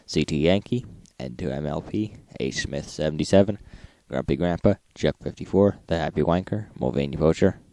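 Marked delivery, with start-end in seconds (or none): none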